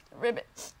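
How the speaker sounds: whispery croaked frog sob; croaky ribbit sob. Acted. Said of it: "croaky ribbit sob".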